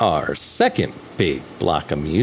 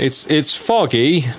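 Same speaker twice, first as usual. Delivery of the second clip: slow, pitched-up mock British drawl; mock British accent; 6 semitones higher, slower than usual. Host joking about foggy London in a mock British accent. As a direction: play the foggy-London cliché. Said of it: "the impersonation I did, the little accent".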